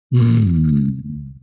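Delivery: low thoughtful hmm; low murmur. A narrator's reading. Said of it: "murmured Moses".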